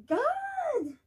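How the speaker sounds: high anguished cry to heaven. Acted. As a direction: cry out in exasperation. Outburst of exasperation.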